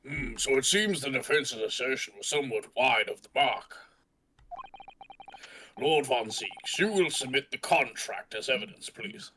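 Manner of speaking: judge voice. Acted. Judge voice in trial.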